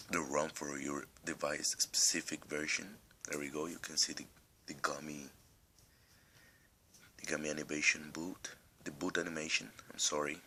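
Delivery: ordinary talk, nothing marked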